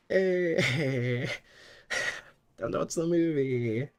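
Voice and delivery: mock-announce it like a returning streamer, only lightly voiced; playful half-hearted put-on voice; different voice. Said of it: "It's hard to do a different voice while sick".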